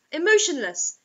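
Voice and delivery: ordinary talk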